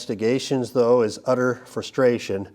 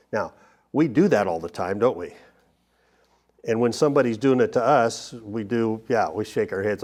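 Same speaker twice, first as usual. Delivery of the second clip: normal talking voice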